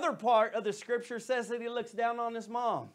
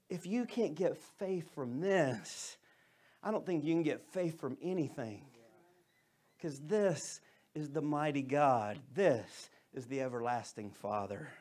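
softer and lower, slowing down; hushed conviction; 8 semitones lower, 8 dB quieter than usual. Real, not acted. Pastor quietly stressing faith to his congregation, lower and softer. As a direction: draw the room in close. Sermon turns reflective and hushed.

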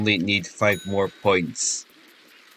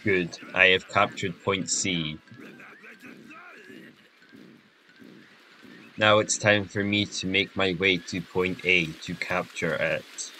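monotone voice; 2 semitones higher, 6 dB quieter than usual. Pretend. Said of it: "saying stuff in a monotone voice".

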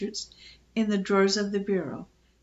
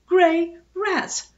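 high, horrified cry with each word drawn out; alarmed squeal; 9 semitones higher, 5 dB louder, much slower than usual. A narrator's reading. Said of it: "squealed Phoebe, slamming the door".

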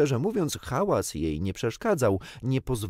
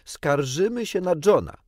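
loud, high tattling cry; calling out; 9 semitones higher, 6 dB louder than usual. A narrator's reading. Twins telling on John, called out. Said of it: "zawołały bliźniaki".

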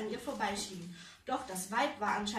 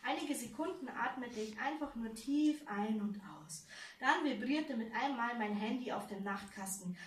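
ordinary delivery

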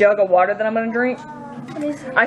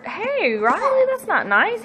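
scolding; 11 semitones higher, slower than usual. Real, not acted. Scolding a kid.

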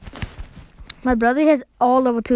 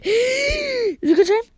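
slow, higher, half-crying shout; startled cry, much higher; panicked gasp; 11 semitones higher, much slower than usual. Real, not acted. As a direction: gasp then blurt it out in panic. Panicked mid-game.

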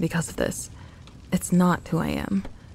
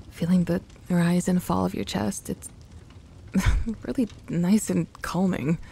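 ordinary talk, nothing marked

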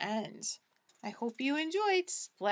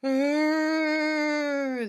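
spooky groan. Acted. Hidden creature groans in the dining room, spooky groan.

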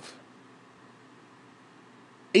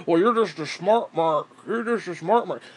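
lightly voiced smug critic, dismissive; a hint of a know-it-all fan, brushing him off; mocking a smug fan. Ranter mocks the fans who call him a smart mark, in a lightly voiced smug tone. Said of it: "I'm not a smart mark".

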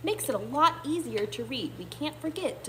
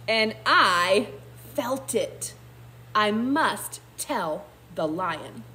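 put-on loud, deliberate reading to children; story narration; 6 dB louder, slower than usual. A narrator's reading. Teacher reading a rain story aloud, dramatic narration. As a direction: read it slowly with big emphasis on key words.

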